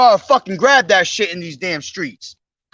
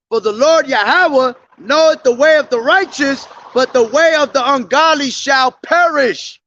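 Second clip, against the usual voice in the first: raised, proclaiming preacher's read that ends in a shout; preaching scripture; 5 semitones higher than usual. Acted. Street preacher proclaiming Psalms to his listeners, building to a shout. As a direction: call out the verse to the crowd.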